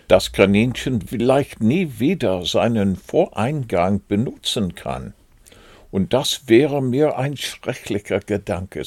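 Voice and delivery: plain reading voice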